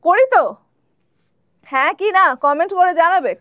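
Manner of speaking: ordinary delivery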